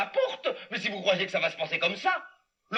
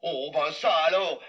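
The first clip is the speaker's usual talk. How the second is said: ordinary delivery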